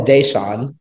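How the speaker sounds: "Deshaun" said as DAY-shawn; correct pronunciation. Real, not acted. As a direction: give the name "Deshaun" a clear DAY to start. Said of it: "did I say it right".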